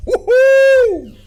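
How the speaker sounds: high, loud woohoo; excited whoop. Retold or imitated. Whooping over the woman.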